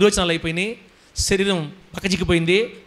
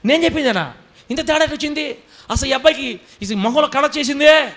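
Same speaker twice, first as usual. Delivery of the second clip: incredulous doctor; 7 semitones higher than usual. Retold or imitated.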